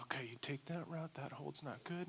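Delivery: put-on nervous kid sizing up the wall; muttering to self. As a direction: plan it quietly to yourself. Kid planning routes to himself.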